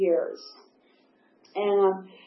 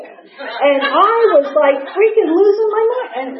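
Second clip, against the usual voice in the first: much higher, louder exasperation; exasperated; 8 semitones higher, 4 dB louder than usual. Real, not acted. Telling listeners how the condo guy drove her crazy, exasperated and high. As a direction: let it rise into losing it. Exasperated at petty meeting talk.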